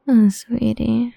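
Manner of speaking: ordinary delivery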